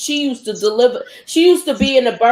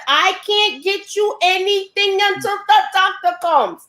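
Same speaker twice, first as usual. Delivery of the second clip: slow, deliberate, higher mock-accented English; 8 semitones higher than usual. Nurse refusing the woman in labour, slow accented voice. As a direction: lay it out slowly word by word, in an accent. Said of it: "broke it down slow".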